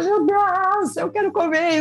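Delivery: high, drawn-out wail of longing; mock lament. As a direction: play up the anguish of craving food. Acting out her past food craving.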